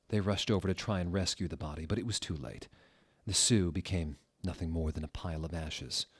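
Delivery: plain reading voice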